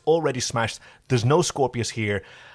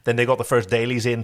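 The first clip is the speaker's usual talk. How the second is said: usual speaking voice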